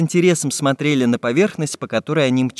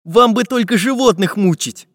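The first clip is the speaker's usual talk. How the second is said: high-pitched reproachful grumble; grumbling; 8 semitones higher, 4 dB louder than usual. A narrator's reading. Artist scolds pirate for tormenting animals, grumbling. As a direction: scold with a touch of irritation. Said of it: "проворчал Карандаш".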